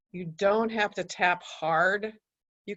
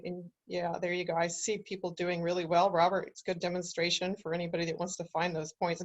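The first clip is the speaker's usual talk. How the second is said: usual speaking voice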